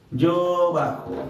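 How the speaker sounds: very slow, both words stretched; slow dictation. Real, not acted. Teacher repeating a student's correct answer, slow dictation. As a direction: draw it out as if writing along.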